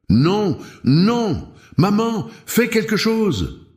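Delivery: high-pitched cry of a desperate son; desperate plea. A narrator's reading. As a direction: beg for help in a high, desperate voice. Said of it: "Le désespoir dans sa voix".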